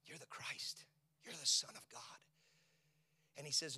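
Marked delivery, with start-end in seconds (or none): 0.1-2.2 hushed, breathless whisper; whispered confession. Acted. Peter whispering his answer to Jesus. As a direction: breathe it like a secret.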